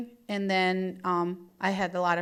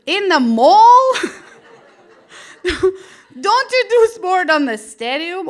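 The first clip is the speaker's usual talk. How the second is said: high, loud, drawn-out shock; retelling her stunned reaction to mall walkers; shocked disbelief; 8 semitones higher, 10 dB louder, slower than usual. Retold or imitated. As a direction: squeal the question in disbelief. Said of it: "I'm like".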